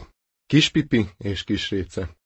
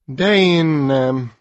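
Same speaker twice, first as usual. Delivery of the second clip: slow, weary sighing refusal; 3 semitones higher, much slower than usual. Acted. Chick refusing another bath, sighing. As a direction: sigh it out, had enough. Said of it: "sóhajtott".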